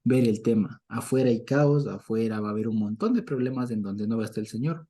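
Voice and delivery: ordinary delivery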